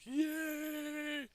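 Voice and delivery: high, mock cheer of joy; a put-on college kid's delighted cheer. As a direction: squeal a retold yay, way up high. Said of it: "and we were just like".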